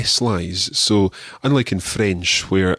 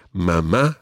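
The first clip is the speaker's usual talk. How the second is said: Mama said ma-MA, second syllable stressed; Mama with a rising, stressed ending; stress on last syllable. Shown as a demonstration. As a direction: lean on the final syllable of Mama. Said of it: "both have accents on the last syllable".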